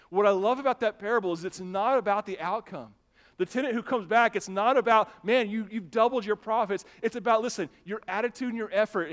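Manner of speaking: high, loud, emphatic sermon; impassioned preaching. Real, not acted. Pastor explaining the parable of talents, impassioned preaching. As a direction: press the lesson on the congregation.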